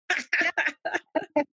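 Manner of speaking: short amused laugh at her own worry; real laugh. Real, not acted. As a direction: laugh it off, light and high.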